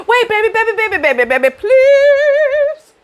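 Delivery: high, sing-song mock pleading; begging boyfriend. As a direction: whine and beg her back.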